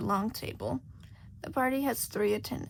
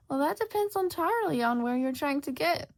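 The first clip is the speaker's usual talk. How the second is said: higher, louder sly cat voice; Cheshire Cat; 5 semitones higher, 4 dB louder than usual. Acted. Cheshire Cat answering lost Shota, sly and amused. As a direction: purr it like a teasing cat.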